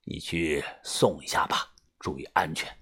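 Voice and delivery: low, soft elder voice; resigned gang boss. A narrator's reading. Boss sending his man to escort her, resigned, hushed. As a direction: give in quietly, low and weary. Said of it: "把头无奈的摆摆手说".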